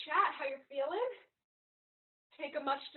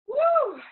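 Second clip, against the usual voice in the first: cheer; 12 semitones higher, 16 dB louder than usual. Real, not acted. Trainer cheering mid-workout.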